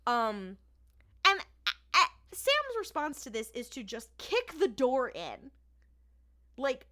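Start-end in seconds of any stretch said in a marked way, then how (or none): none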